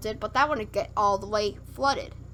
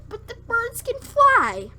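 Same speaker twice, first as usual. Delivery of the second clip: very high, louder objection; exasperated protest; 13 semitones higher, 5 dB louder than usual. Real, not acted. Reader objecting that every bird died, exasperated. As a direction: squeal it in indignant disbelief.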